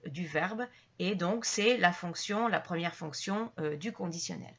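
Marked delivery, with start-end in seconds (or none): none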